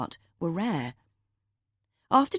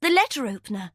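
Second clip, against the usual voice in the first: sudden, higher blurt; 6 semitones higher, 4 dB louder, faster than usual. A narrator's reading. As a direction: blurt it out before thinking. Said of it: "Phoebe blurted".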